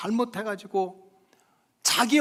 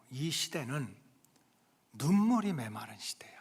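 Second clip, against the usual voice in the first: soft whispered lament; 7 semitones lower, 7 dB quieter than usual. Real, not acted. Preacher lamenting dry age, whispered. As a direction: let it fall to a whisper.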